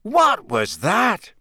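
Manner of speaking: slow, high, alarmed question; startled. A narrator's reading. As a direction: caught off guard by a shriek. Startled by horrible noise.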